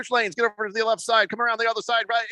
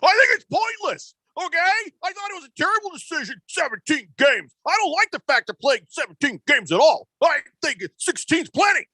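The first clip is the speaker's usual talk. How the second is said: higher, worked-up hammed-up rant; mock-furious, pitched-up complaining; angry Tom Brady; 4 semitones higher than usual. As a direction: play him as a fed-up star quarterback venting. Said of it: "read this as angry Tom Brady".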